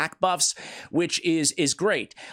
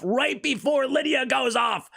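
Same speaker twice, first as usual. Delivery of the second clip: raised, exasperated gamer outburst; exasperated shout; 9 semitones higher than usual. Real, not acted. Gamer watching the attack go off, exasperated. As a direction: call it out in higher-pitched dismay. Attack timing goes wrong in game.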